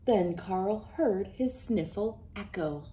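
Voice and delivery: ordinary delivery, nothing marked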